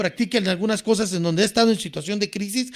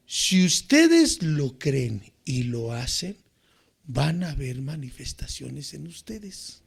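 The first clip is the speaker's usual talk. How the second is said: slow, low and soft; slow right down and drop the voice low; solemn, hushed promise; 7 semitones lower, 6 dB quieter, slower than usual. Real, not acted. Preacher promising listeners manifestations if they believe, solemn and hushed.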